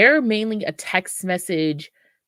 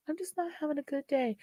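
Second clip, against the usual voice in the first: mopey sad voice; 10 semitones higher, 11 dB quieter, faster than usual. Retold or imitated. Sad friend answering the call.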